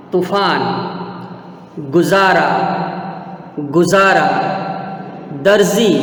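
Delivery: z in बाजार, गजब, जमाना, गुजारा, दर्जी, f in फिल्म, तूफान; isolated model words, बाजार to दर्जी, z for j and f for ph; nukta pronunciation. Shown as a demonstration. Teacher drills students, बाजार, गजब, दर्जी with z. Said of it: "मैं कैसे करता हूँ नुक्ता वाले शब्दों का उच्चारण".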